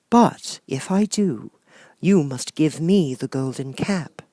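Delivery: high, gentle sorceress voice; kind witch Glinda. Acted. Glinda promising Dorothy a way home, high and gentle. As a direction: soothe the child like a kind fairy.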